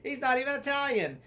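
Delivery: high, loud, incredulous protest; mock outrage. Reviewer voicing people upset at the casting, mock indignant. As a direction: imitate upset fans in a squawked complaint. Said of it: "a lot of people were upset".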